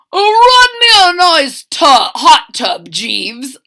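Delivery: high, loud pompous villain ordering his butler; hammed-up bossy villain. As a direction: bark orders like a spoiled bad guy.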